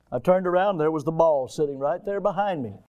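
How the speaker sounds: normal talking voice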